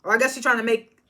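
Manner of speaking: normal talking voice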